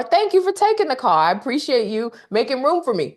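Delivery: mock grateful caller.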